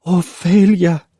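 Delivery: high, slow, soothing name; calm her daughter; mother soothing crying girl; gentle mother. Acted.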